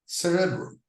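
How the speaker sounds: cerebrum stressed on the first syllable, CE-rebrum. Shown as a demonstration. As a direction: lean on CE in cerebrum. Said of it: "in the first syllable".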